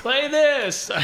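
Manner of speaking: excited announcement. Acted. Excited show name call.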